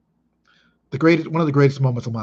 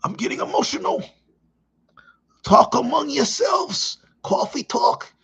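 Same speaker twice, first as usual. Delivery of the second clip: much higher, choked-up mock host voice; Coffee Talk parody; 14 semitones higher than usual. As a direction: fake getting verklempt like the sketch host. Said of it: "a little reference from Saturday Night Live".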